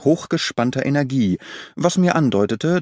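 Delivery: ordinary delivery, nothing marked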